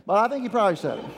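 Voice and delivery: ordinary talk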